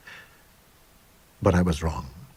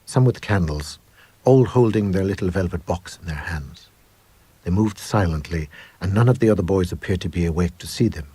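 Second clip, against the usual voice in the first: ordinary delivery, nothing marked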